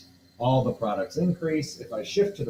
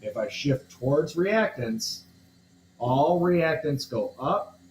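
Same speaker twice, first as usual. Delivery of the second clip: teacher slowing down to drive home the important piece; slow emphatic teaching; 3 semitones higher, slower than usual. Shown as a demonstration. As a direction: spell out the key rule slowly for the class. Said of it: "this is the important piece".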